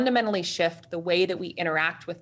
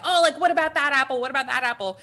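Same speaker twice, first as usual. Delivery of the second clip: quick, higher, louder excited suggestions; eager chiming in; 6 semitones higher, 4 dB louder, faster than usual. Retold or imitated. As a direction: jump in eagerly to help pick. Customers in line chime in.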